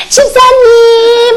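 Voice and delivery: sweet, high, drawn-out call to the deaconess; cute aegyo call. Retold or imitated. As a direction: coo it out slowly like a doting child. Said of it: "막 이러고 내가 또 흉내를".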